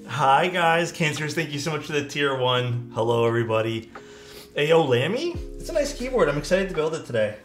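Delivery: ordinary delivery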